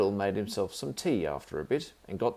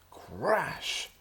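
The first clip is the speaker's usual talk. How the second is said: ordinary delivery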